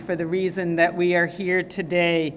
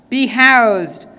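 normal talking voice

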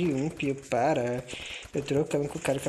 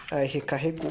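normal talking voice

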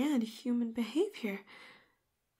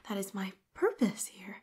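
usual speaking voice

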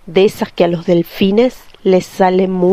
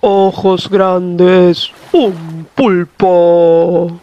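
very slow, relishing menace; menacing shark voice; much slower than usual. A narrator's reading. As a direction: savour every word hungrily. Shark savours prey.

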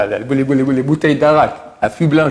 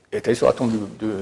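ordinary talk, nothing marked

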